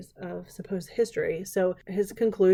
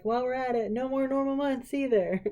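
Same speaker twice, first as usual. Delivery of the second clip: higher-pitched mock declaration; mock demand; 5 semitones higher than usual. French revolutionaries adding a demand, mock declaration.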